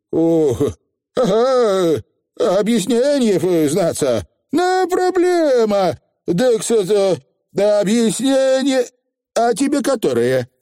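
высокий голос коротышки, неловко тянет время; мнись и выкручивайся высоким голоском; юлящий фигль. A narrator's reading. Фигль юлит перед Тиффани. (юлящий фигль)

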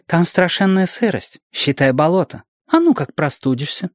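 a hint of an anxious guardian; lightly voiced fussing mother. A narrator's reading. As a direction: fuss lightly over a child's health. Mrs Owens warns of a cold.

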